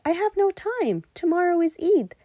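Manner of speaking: high, hurried refusal; a touch of fluster, too busy; lightly voiced wife, pressed for time. A narrator's reading.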